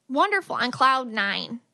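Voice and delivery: ordinary talk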